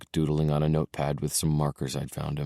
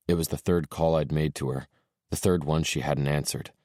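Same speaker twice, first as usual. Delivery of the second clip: ordinary delivery, nothing marked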